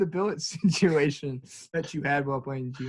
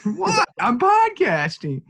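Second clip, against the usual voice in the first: high-pitched yelling, far above usual voice; mock yell; much slower than usual. Co-host imitating Easton yelling while podcasting, high mock yell. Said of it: "he yells".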